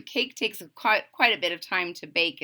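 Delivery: plain reading voice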